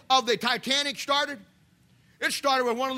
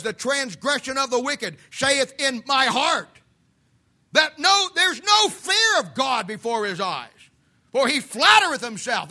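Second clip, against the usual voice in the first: preaching scripture; 5 dB louder than usual. Acted. Reading Psalm loudly.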